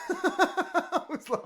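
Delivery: high laugh breaking into the words; giggling as he goes on. Real, not acted. Laughing at own story.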